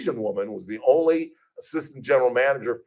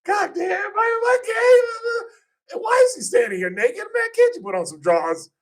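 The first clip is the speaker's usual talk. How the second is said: shocked yelling. Retold or imitated. Host retelling a naked interview, shouting in shocked disbelief. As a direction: yell it in flustered disbelief. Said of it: "I was like".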